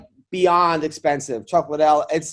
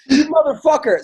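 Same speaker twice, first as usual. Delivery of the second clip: higher, louder retold outburst; mock exasperated cursing; 9 semitones higher, 5 dB louder than usual. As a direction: imitate a wife cursing her husband. Wife swears at husband, retold.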